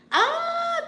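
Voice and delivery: high, loud held "ah"; delighted squeal. Real, not acted. Host about to thank a viewer, delighted squeal.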